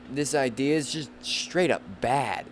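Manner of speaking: ordinary delivery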